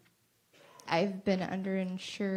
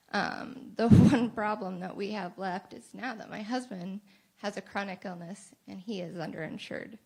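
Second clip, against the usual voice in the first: ordinary talk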